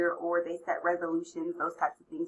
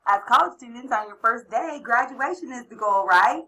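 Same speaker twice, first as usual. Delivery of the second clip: high, loud hype; excited cheer; 5 semitones higher, 8 dB louder than usual. Acted. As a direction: pump up the room. Cheering the graduation goal.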